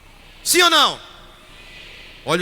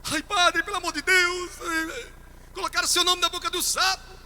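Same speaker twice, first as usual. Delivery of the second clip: higher, pleading voice of a frantic woman; desperate pleading sister; 8 semitones higher than usual. Retold or imitated. A frantic sister begs the priest about the toad, pleading high. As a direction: plead like a panicked churchgoer.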